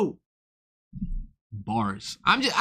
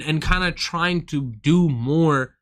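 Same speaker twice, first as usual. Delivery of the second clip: normal talking voice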